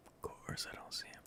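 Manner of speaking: breathless whispered complaint; a hushed, exasperated whisper. Real, not acted. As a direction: whisper it in frustration. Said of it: "And of course, I don't see him".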